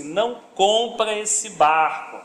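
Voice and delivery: slow, drawn-out command; solemn divine warning. Acted. God warning a brother off a purchase, slow and emphatic. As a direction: stretch each word of the warning. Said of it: "que Deus falou assim".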